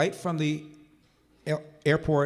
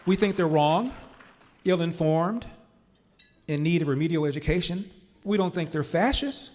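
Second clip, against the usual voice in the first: impassioned speech; 4 semitones higher than usual. Real, not acted. Speaker rallying his audience against being called fascists, impassioned. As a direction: drive the point home with rising force. Builds to passionate appeal on Democrats.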